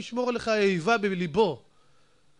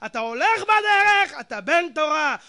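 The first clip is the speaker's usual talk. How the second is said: higher, louder hammering sermon; fiery preaching; 8 semitones higher, 5 dB louder than usual. Acted. Rabbi rebukes his listeners about Torah, shouting high. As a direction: thunder at the congregation.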